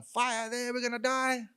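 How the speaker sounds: high-pitched imitation of freaking out; mock panicked scream. Old lady at the window seeing fire, mock high panic. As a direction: cry out in hammed-up terror. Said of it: "screaming out the window, freaking out".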